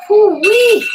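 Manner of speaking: loud high cheer of relief; excited whoop. Real, not acted. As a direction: whoop it out after the last rep. Cheer after finishing the set.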